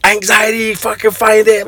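high, mock-exasperated scolding; mom yelling. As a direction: imitate mom snapping at her son. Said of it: "she's yelling at me".

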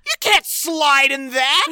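retold indignant outburst, half laughing; imitated yell. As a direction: yell it in mock outrage. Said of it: "The first thing someone yells is".

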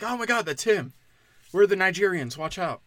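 high, hammed-up alarmed imitation; mock Jussie Smollett. Mocking an actor who reminds him of Jussie Smollett, high and alarmed. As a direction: squeal it like fake panic. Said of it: "Jussie Smollett being like".

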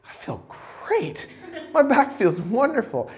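soft, slow, breathy relief after the massage; relieved and blissful. Retold or imitated. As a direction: sigh it out, feeling loosened up. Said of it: "I was like".

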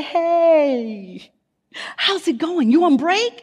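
high, upbeat husband greeting; cheerful husband. Retold or imitated. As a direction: sound friendly and easygoing. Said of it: "with a smile in his voice".

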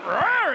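high, loud possessed roar; a sudden beastly roar; demonic roar. Retold or imitated. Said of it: "She went".

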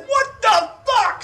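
high, loud and slowly drawn out; startled mock yell. Woken thirty minutes later by the noise, exasperated shout. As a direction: yell it word by word in exaggerated disbelief. Noise wakes him again, retold.

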